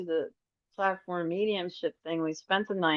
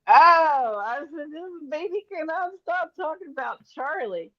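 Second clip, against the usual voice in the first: light mock voice; 11 semitones higher, 5 dB louder than usual. Quoting herself, high far voice.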